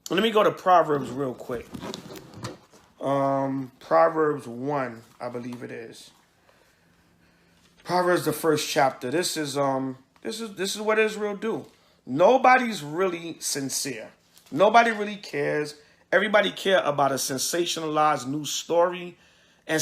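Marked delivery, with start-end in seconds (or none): none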